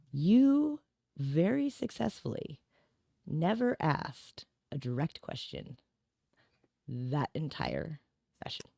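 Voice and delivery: slow, drawn-out words with long pauses; deliberate self-reproach. Retold or imitated. Client telling themself off after re-listening, slow and deliberate. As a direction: spell out the realization to yourself, slowly, piece by piece.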